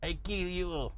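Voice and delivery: laughing threat in a put-on Latin accent; mock Spanish-speaking fighter. Fighter threatening a rival in a mock Spanish accent. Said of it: "he likes to talk in Spanish".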